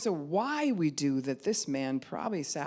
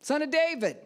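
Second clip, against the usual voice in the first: higher and louder plea; crying out; 11 semitones higher, 6 dB louder than usual. Acted. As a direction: believer refuses to stay a victim, cries out. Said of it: "I'm gonna cry out".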